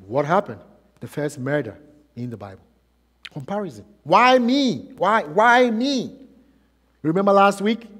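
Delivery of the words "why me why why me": high-pitched, drawn-out "why me"; acted jealous lament; aggrieved complaint. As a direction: protest it as the one passed over. Acting out Cain's jealous complaint.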